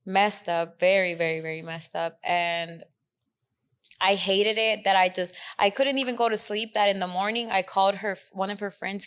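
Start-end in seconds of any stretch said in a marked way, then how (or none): none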